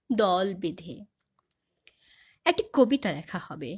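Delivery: measured, dramatic recital with swelling phrases; poem recitation. Acted. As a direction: declaim the poem to an audience, pausing between phrases. (নাটকীয় কবিতা আবৃত্তি)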